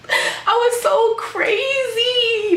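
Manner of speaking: high, drawn-out squeal through laughter; laughing in embarrassment. Real, not acted. Vlogger recalling her cringy moment, real squealing laugh. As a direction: wail it slowly while cracking up at yourself.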